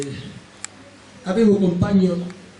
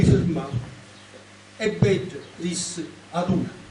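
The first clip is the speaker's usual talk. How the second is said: measured dialect poem reading, higher and louder than chat; poem recitation; 4 semitones higher, 4 dB louder than usual. Acted. Poet recites "Martino" to an audience, in measured recitation. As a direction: recite it line by line with pauses.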